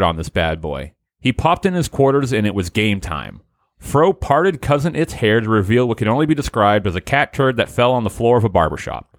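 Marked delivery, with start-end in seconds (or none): none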